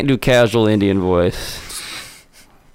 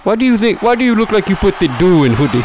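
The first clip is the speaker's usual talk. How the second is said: mocking taunt; 9 semitones higher, faster than usual. Roast taunt voice.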